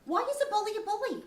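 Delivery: normal talking voice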